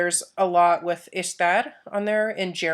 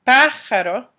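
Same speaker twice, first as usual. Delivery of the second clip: Pájaro, first A punched higher and louder; stress on first syllable; 4 semitones higher, 7 dB louder than usual. Shown as a demonstration. Teacher repeating for students, Pájaro stressed on first A. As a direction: hit the opening A of Pájaro. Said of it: "has an accent over the first A".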